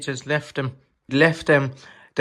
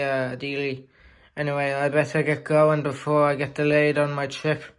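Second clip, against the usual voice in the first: plain reading voice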